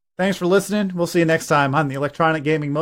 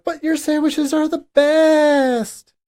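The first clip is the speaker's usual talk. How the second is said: imitating wife; 10 semitones higher, 4 dB louder, much slower than usual. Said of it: "every time it's like".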